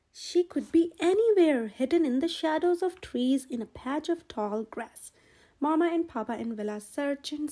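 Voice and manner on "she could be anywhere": ordinary delivery, nothing marked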